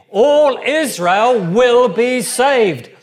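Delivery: rally the congregation with every word; preaching at full force. Real, not acted. Preacher urging the church to declare it, loud and rising. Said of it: "Come on, just say it with me".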